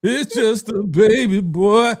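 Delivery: high, slow, laughing delivery; teasing drawn-out crowing; playful laughing tease. Pretend. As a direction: draw it out high through laughter. Playfully draws out Baby Boy, laughing.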